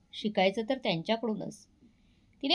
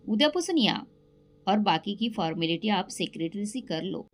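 ordinary delivery

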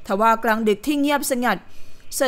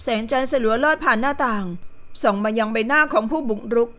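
plain reading voice

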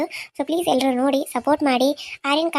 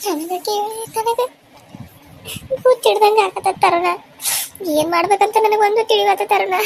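raised, distraught wail; acted crying; 7 semitones higher, 3 dB louder than usual. Distraught relative wailing to Taruna, crying. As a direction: wail helplessly as if losing your wits. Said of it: "ಬೀಗ್ರು ಯಾಕೆ ಅಳತಾರ?".

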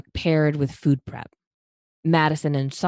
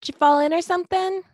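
high, fast teasing yell through a door; faster than usual. Acted. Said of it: "a voice yelled through the bathroom door".

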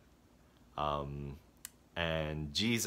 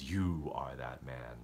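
low, soft and slow reveal; grave Nathan; 7 semitones lower, 8 dB quieter than usual. Retold or imitated. Nathan quietly telling David he is the man, low and slow. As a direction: drop it quietly like a verdict. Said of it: "Nathan turns around and says".

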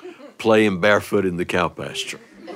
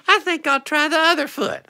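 put-on high child's voice; a little boy getting mischievous; little brother's voice. Little brother decides on the other foot, high child voice. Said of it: "He said".

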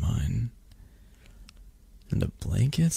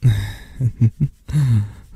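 louder bubbly chuckle; affectionate giggle; 2 semitones higher, 6 dB louder than usual. Acted. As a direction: giggle fondly at a pet. Giggling while petting the kitty.

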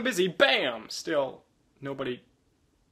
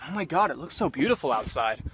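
usual speaking voice

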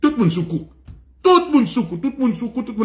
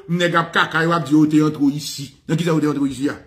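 normal talking voice

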